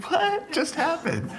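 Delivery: high, slow, laughing bafflement; bewildered laugh. Retold or imitated. Recalling his own confusion after the father's blessing, high and laughing. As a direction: let the confusion spill out in a laugh. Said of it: "I was like".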